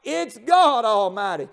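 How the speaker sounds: high, loud, tearful preaching cry; preaching at full force. Acted. Preacher to the congregation on God's power, full-force tearful preaching.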